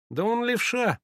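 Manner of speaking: высокий голос мальчишки; ответь высоко, по-мальчишечьи; мальчишеская реплика. A narrator's reading. Мальчик отвечает группе, детский голос. (высоким мальчишеским голосом)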